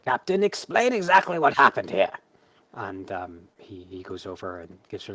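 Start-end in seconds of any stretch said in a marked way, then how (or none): 0.0-2.1 higher, louder imperious order; stern commanding zealot. Acted. Arch Zealot demanding the captain explain, loud imperious order. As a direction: demand answers like a stern matriarch. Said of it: "and says".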